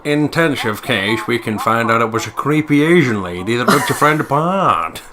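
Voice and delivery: mock detective. Playing a pompous detective revealing the case, slow and loud. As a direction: announce the verdict with fake gravity.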